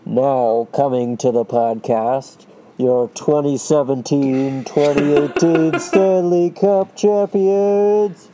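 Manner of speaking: big, slow, booming announcer voice; fighting game announcer. Pretend. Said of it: "You wanna try that again?".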